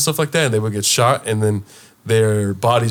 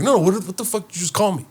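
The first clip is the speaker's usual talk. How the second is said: raised-pitch retold demand; making a scene; 7 semitones higher, faster than usual. Host demanding guest repeat the insult, confrontational. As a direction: press him indignantly for an answer. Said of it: "I made a whole fucking scene".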